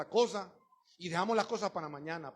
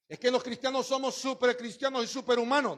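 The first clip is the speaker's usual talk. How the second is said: mock boast; 10 semitones higher, 9 dB louder than usual. Mocking Christians who claim perfection.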